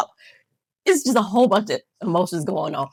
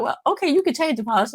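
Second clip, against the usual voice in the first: high, pushover voice; higher mock surrender; giving in; 8 semitones higher than usual. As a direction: cave in with a bright, reluctant okay. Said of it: "I'm like".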